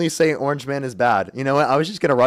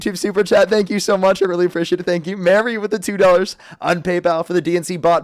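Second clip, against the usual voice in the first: put-on voice; 4 semitones higher than usual. Streamer thanking donors mid-argument, higher put-on voice. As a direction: ham up the donation thanks. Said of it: "I'm done with the voice".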